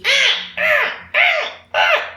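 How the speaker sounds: high, loud imitated cries of a scared child; imitated distressed cries. Imitating the scared boy resisting the bathroom, high distressed cries. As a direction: mimic the boy's panicked wails, high-pitched. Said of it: "and he's".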